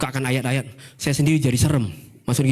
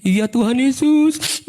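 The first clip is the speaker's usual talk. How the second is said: slow, high, louder pious yes; mock devout agreement; sugary promise; 9 semitones higher, 4 dB louder, much slower than usual. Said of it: "yang kayak kita tuh".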